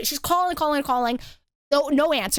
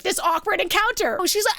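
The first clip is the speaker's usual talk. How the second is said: usual speaking voice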